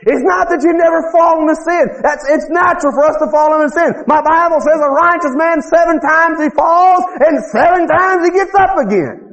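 high-pitched, driving revival preacher; full-force preaching. Real, not acted.

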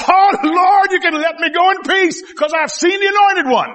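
high shouted praise; old prophet shouting. Retold or imitated. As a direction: cry out to the Lord in joy. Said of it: "He started shouting".

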